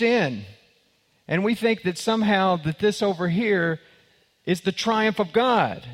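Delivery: preaching at full force. Real, not acted. Preacher drives the point home.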